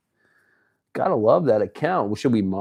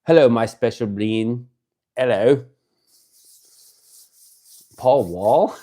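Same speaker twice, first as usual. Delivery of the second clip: odd put-on voice, louder, greeting a fan; silly greeting voice; 6 dB louder than usual. Streamer greeting a favourite viewer, goofy put-on hello.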